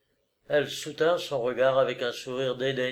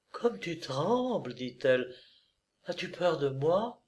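soft and gentle; 4 semitones higher, 6 dB quieter than usual. Acted. Tender question to frightened lover.

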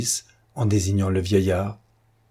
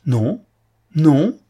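building to a loud, high cry; louder and higher with growing conviction; ardent outcry; 7 semitones higher, 6 dB louder than usual. A narrator's reading. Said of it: "s'écria Don Luis avec une conviction". (cri de plus en plus ardent et fort)

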